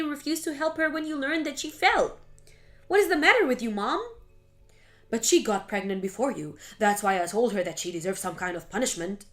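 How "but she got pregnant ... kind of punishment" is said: mother justifies herself, voice low; low defensive mother. Acted. Mother explains to Melissa why she punished Mary, defensive. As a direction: defend the cruelty matter-of-factly.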